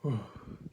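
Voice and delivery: slow, deep breath in; deep dark breath. Shown as a demonstration. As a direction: draw a dark, open breath. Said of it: "like a very deep, dark breath".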